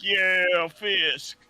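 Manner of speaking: excited fan cheer; cheering supporter. Retold or imitated. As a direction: shout it like a hyped rally fan. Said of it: "they're just like".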